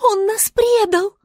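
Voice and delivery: high, loud accusing cry; star's outcry. A narrator's reading. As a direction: exclaim it high, as a betrayed star. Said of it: "воскликнула звезда". (возмущённый возглас звезды)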